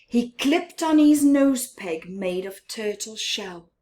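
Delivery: plain reading voice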